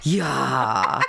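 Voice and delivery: low, drawn ya; "Ya" said low, as a Kwakwala ya. Shown as a demonstration. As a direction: drop the ya low. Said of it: "We say it lower".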